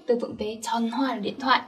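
usual speaking voice

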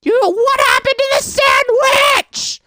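very high, slow, loud outraged shout; outraged shriek. Real, not acted. Player discovering the child's sandwich vanished, outraged shout. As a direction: scream it in disbelief at the screen.

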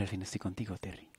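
soft breathy whisper; hushed tender whisper. Acted. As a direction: whisper it to him alone. Intimate reunion moment.

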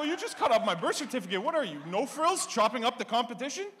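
high, loud incredulous retort; loud comeback. Retold or imitated. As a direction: fire it back at her, loud and disbelieving. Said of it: "I go".

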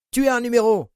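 high loud contemptuous spit of words; spat in her face. A narrator's reading. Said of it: "lui cracha-t-il au visage".

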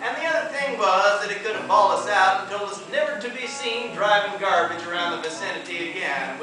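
usual speaking voice

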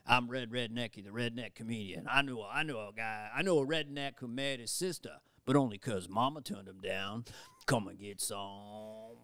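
put-on worst comedian in the world, Southern accent. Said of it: "silly character, Red Rednecky ... Southern comic".

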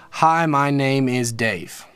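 each syllable of "Hi, my name is Dave" spoken slowly and evenly; slow syllable demo. Shown as a demonstration. Teacher counting syllables for a student, slow and even. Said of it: "there's five syllables right there".

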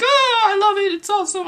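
very high, squeaky delight; excited cartoon character. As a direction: voice a thrilled little figure, squeaking high. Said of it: "He's like".

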